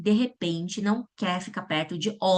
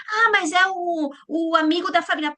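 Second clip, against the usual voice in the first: mocking objection; 9 semitones higher, 3 dB louder than usual. Parent objecting, mocked.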